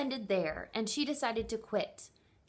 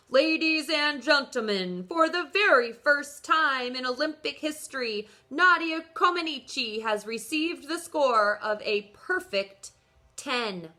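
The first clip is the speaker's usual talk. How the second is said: louder, higher stadium announcer call; loudspeaker announcer; 4 semitones higher, 8 dB louder than usual. Acted. Olympic announcer to the crowd, loud and higher. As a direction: boom it out over the arena speakers. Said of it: "as a voice announced over the loudspeaker".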